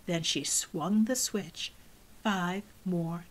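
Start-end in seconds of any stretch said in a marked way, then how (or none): none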